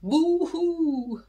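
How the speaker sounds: silly mock crying; mock boo-hoo. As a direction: fake cry boo-hoo for the kids. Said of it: "And they're going".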